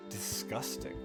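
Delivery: ordinary talk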